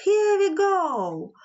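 high, drawn-out departure call; gleeful storybook call; excited send-off. A narrator's reading. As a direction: stretch it out like a train setting off. Flying train sets off.

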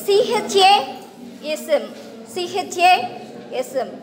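letters spelled out one by one, slowly; slow spelling out. Shown as a demonstration. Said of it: "first one చదువుతారా?".

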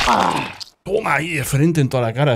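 normal talking voice

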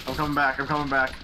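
ordinary delivery

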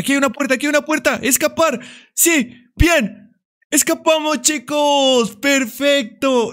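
high joyful victory cheering; excited cheer. Real, not acted. Finding the exit door.

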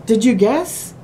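ordinary delivery

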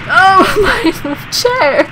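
high, loud drawn-out exclamation; high yelp of dismay; laughing cry. Real, not acted. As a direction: cry out loudly through a laugh. Cry after crash in game.

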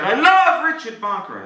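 loud, high, stretched-out yell; top-of-lungs shout. Shown as a demonstration. Hypnotist showing the volunteer what to shout, loudly. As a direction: belt it out slowly as a model. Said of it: "at the top of your lungs and you shout".

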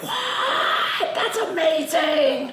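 a high, breathy yelp of delight, then a slow marvel; amazed kid. Retold or imitated. Kid watching Karate Kid, awestruck by the twist. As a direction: squeal with disbelief like a kid watching. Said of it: "you're like".

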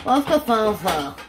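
slow, posh-ish showy repeat; mock fancy. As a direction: stretch it out like you're being fancy. Repeats phrase, second more showy.

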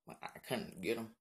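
ordinary talk, nothing marked

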